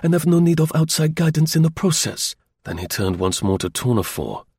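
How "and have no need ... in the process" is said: high, self-assured rebuff; curt retort. A narrator's reading. Masterful Chauvelin rejects outside guidance, curtly. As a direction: dismiss the advice curtly, pitched up. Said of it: "retorted Chauvelin curtly".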